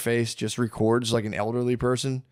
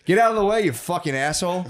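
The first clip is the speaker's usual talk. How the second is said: mock rage-bait yell; 6 semitones higher than usual. Prankster shoving an old man's cart, mock yell. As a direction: bark it like a prankster picking a fight. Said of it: "just going".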